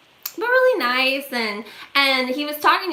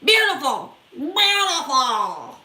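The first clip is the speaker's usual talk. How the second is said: a drawn-out, lightly voiced creepy stranger, slowing right down; creepy catcall; 5 semitones higher, slower than usual. Stranger on a bench calls after a passing woman, mock creepy drawl. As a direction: purr it like a leering stranger. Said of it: "I hear".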